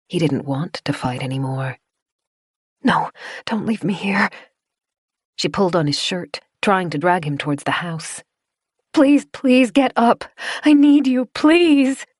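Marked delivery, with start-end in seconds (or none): 2.8-4.3 whispered plea; a freezing boy whispering; weak whisper. Acted. Resigned, half-frozen plea. 8.9-12.0 high, loud and slow pleading; girl begging her friend to rise; desperate begging. Acted. Dragging him, begging.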